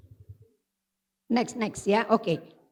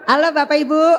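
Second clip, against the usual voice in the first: loud, raised-pitch call to the room; much louder, hailing the audience; 4 semitones higher, 12 dB louder than usual. Real, not acted. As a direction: call out over a noisy crowd for attention. Calling a chattering audience to attention.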